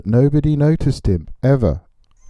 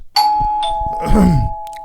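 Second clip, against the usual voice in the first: throat clear. Acted. Throat cleared before speaking.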